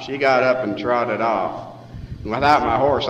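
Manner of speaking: ordinary delivery, nothing marked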